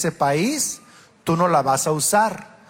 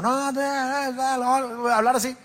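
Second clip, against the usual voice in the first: much higher, brushing it off; mock protest; 8 semitones higher than usual. Husband waving off his wife's complaint. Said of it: "era como".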